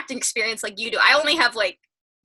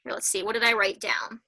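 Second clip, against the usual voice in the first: ordinary talk, nothing marked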